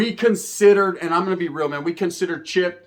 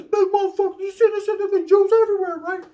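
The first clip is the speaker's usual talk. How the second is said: mock squeal; 13 semitones higher than usual. Joking about getting splashed.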